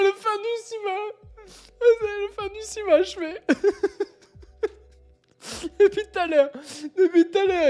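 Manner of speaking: high-pitched, helpless laughter with tears, words squeezed out; can barely speak for laughing; crying with laughter. Real, not acted. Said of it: "je pleure de rire". (pleure de rire, voix aiguë entrecoupée)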